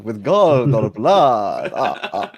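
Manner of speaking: slow, loud vampire count voice; Count Von Count. Retold or imitated. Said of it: "sound like Count Von Count".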